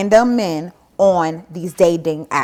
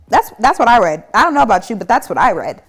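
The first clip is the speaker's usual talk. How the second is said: loud, stressed that's what I read; loud mock-outraged insistence; loud indignant; 3 semitones higher, 8 dB louder, faster than usual. Real, not acted. Indignant reaction to creepy message.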